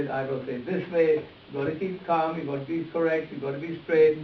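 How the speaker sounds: ordinary talk, nothing marked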